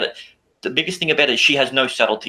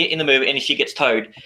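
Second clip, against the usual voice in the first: ordinary talk, nothing marked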